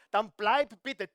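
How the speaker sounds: usual speaking voice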